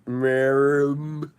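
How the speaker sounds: drawn-out, garbled mock speech; one slurred, swelling nonsense word; Helen Keller. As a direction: mimic someone unable to form words. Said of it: "didn't quite know how to speak".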